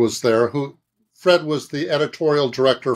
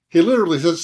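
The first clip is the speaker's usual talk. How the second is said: ordinary talk, nothing marked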